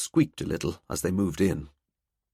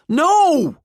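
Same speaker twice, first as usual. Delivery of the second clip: high, loud shout over screaming; 9 dB louder than usual. A narrator's reading. Valkyrie shouting over the terrified pair's screams. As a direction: yell to cut through the panic. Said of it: "Valkyrie shouted".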